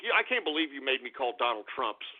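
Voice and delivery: ordinary delivery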